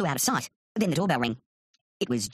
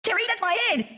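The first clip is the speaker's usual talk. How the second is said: high-pitched cry of protest; indignant cry. A narrator's reading. Artist complains to careless drawing partner, indignant cry. Protesting while Terry draws on him.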